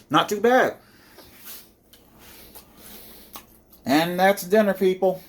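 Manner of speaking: talking with mouth full; words round a bite of food. Real, not acted. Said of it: "apologies for talking with my mouth full".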